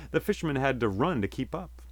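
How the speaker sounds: usual speaking voice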